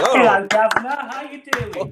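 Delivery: high, loud mock British greeting; jokey British accent greeting the hosts. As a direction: ham up a cartoon British accent. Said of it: "most authentic British accent".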